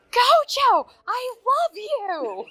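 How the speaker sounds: high, slow squealing fangirl yell; fan shout. Retold or imitated. Fan yelling across the convention, high mock squeal. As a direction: scream it like a fan across a hall. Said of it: "I hear".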